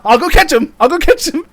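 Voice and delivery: hammed-up eager excuse; laughing mock eagerness. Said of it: "He goes".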